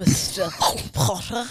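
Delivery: low, halting sinister professor; Severus Snape. Acted. Contestant imitating the professor at Hogwarts, low and halting. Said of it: "This is what Snape does".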